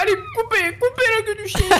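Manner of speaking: urgent. Real, not acted. Urging partner under pressure.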